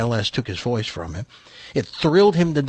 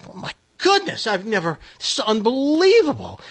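very high, hammed-up astonishment; amazed buyer; 10 semitones higher than usual. Buyer reacts to dog's life story, hammed-up amazement. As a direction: squeal it in disbelief, lost for words. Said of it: "And the guy goes".

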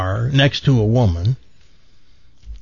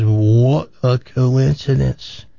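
drawn-out, measured amazement; slow acted wonder; slower than usual. Man reacts to woman's pregnancy news, slow wonder. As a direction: stretch each word out in mock wonder.

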